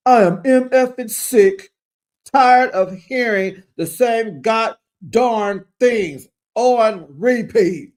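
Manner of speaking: loud, high, drawn-out exasperation; frustrated outburst. Acted. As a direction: hammer out each word slowly, acting fed up. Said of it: "but I'm frustrated".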